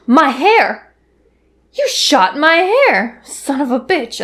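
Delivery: high, indignant shriek over her hair; put-on voice, slow and outraged; outraged princess voice.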